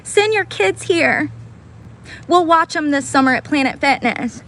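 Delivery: high, slow, sugary mock invitation; sing-song it like a creepy sales pitch; mocking sweet voice.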